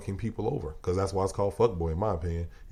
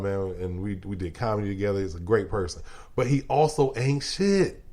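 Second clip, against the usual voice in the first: ordinary delivery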